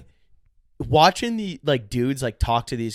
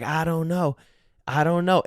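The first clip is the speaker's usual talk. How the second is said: Southern guy; 5 semitones higher than usual. Retold or imitated. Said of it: "these Southern guys".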